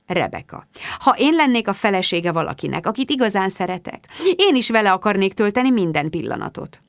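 ordinary delivery

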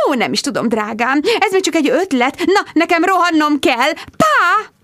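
high, hurried, chirpy goodbye; breezy mother. Acted. Mother dashing off, chirpy and high. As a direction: rush off airily.

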